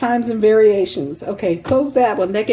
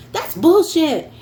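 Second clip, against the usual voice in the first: put-on higher, slow exasperated child; imitating niece; 8 semitones higher, slower than usual. Retelling niece's cussing, mock exasperated higher child voice. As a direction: mimic the niece's outburst. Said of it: "her bad word was".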